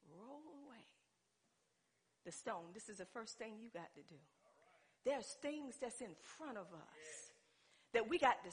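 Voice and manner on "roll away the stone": hushed command. Acted. Preacher quoting Jesus at the tomb, hushed command. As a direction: give the order almost under your breath. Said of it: "First thing that Jesus said is".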